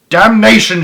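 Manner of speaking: loud, high burst of exasperation; angry shout. A narrator's reading. Duke cursing the short ladder, exasperated shout. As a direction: curse it out loud. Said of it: "shouted the Duke".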